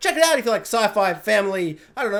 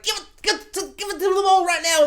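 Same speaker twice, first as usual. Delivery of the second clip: high, frantic, stammered demand; exaggerated grabby binge-watcher voice; mock greedy binger; 9 semitones higher than usual. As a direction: beg for it high and impatient, hammed up.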